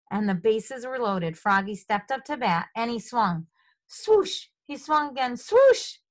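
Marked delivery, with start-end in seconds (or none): 3.9-4.5 high whooshing swing sound; swoosh of a bat. A narrator's reading. 5.4-6.0 high, loud whooshing swing; louder bat swoosh. A narrator's reading. Second bat swing in story.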